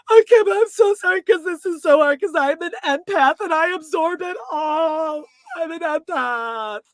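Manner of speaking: high, loud hammed-up gush; mock gushing Lisa. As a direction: overwrought self-pity, slowing at the end. Mocking Lisa's apology.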